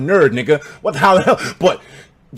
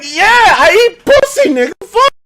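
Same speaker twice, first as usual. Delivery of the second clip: much higher, loud and slow brag; proud shout; 7 dB louder than usual. Retold or imitated. Me bragging to the crowd, high slow proud shout. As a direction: drag it out proudly. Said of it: "And I was like".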